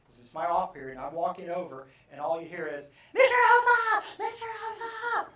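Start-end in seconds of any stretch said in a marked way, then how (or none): 3.1-5.3 very high, loud call across the hallway; screaming name. Retold or imitated. Student screaming for her teacher across the hallway, very high and loud. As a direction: yell it over a crowd of students. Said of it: "Screaming, loud".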